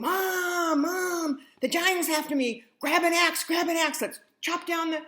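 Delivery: put-on a boy yelling in panic to his mother; screaming. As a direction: scream for Mom as the giant chases. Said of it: "He ran out screaming".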